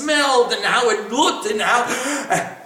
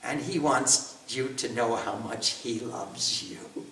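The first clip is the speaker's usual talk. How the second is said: hushed message; 11 semitones lower, 12 dB quieter than usual. Acted. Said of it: "He said".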